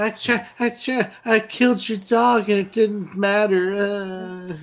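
high, thin put-on killer voice; faint-voiced killer impression, pitched well up; Jeffrey Dahmer. Co-host mocking the actor's killer impression, faint high voice. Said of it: "the really faint voice".

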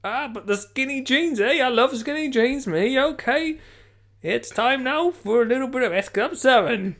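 high, loud hammed-up Geordie DJ; Newcastle accent. Newcastle host cutting guests off for music, mock Geordie accent. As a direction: ignore the guests and chatter on in put-on Geordie. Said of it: "Was that Newcastle".

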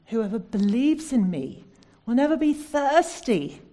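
loud, ringing declaration; proclaiming scripture. Acted. Preacher to congregation quoting Jesus, loud proclamation. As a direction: boom out the promise of Jesus.

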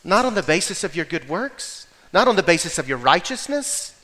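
ordinary talk

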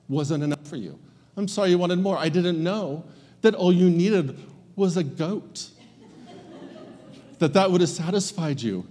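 mock-sorry, dripping with sarcasm; a hurt father answering a complaint with sarcasm; passive aggressive. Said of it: "I would be so passive aggressive".

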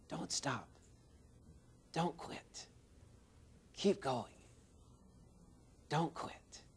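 soft breathy whisper in the ear. Retold or imitated. Master pianist whispers encouragement to the boy, soft whisper. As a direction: hush it close, like encouraging a child at the piano. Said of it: "he was whispering in the boy's ear".

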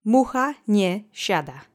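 Mucha nie siada said slowly, each word clear, MOO-ha nyeh SHA-da; slow model phrase. Shown as a demonstration. Host gives Mucha nie siada slowly for learners to repeat. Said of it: "Repeat after me three times".

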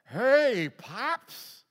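high, loud, slurred-sounding daughter greeting; tipsy daughter. Retold or imitated. Tipsy daughter calling her dad at one in the morning. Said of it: "they start off".

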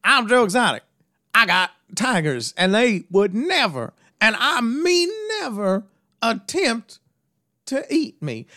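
mocking his campaign ad to voters, with big emphasis; Joe Exotic ad. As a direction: sell it like a cocky candidate. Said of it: "he was like".

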